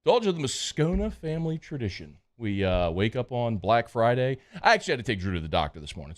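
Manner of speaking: casual live sponsor read. Acted. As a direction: read the ad like a host. Reads a sponsor ad.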